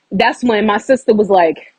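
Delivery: ordinary delivery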